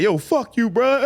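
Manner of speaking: high-pitched indignant yo; indignant retort in a high voice; mock outrage. Acted angry crowd in bit.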